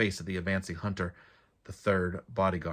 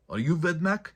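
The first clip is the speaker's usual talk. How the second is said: much higher, louder, startled question; 10 semitones higher, 5 dB louder than usual. A narrator's reading. The trapped bodyguard asks Joven about the moss, startled and high. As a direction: gasp it out in disbelief.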